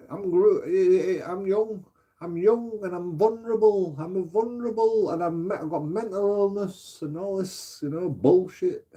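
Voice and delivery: high whiny mock self-pity; mock girlfriend. Mocking girlfriend's claims of vulnerability, high whiny. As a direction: whine it like a fragile victim. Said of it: "I'm like".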